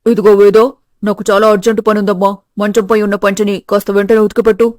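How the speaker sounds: put-on low, loud older man giving an order; deep, pressing household patriarch; father-in-law, hurried. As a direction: voice a father-in-law with an urgent chore.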